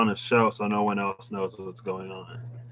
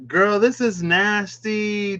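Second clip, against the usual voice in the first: high, slow scandalised sass; sassy disgust; 9 semitones higher, 4 dB louder, slower than usual. Real, not acted. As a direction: call it nasty with sass. Scandalised by the card.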